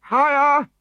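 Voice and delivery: high bossy cry; chief's high call. A narrator's reading. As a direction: call up to the bearers. Chief calling to bearers.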